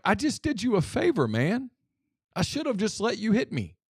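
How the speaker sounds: sarcastic retort. Retold or imitated. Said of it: "And I looked at him like".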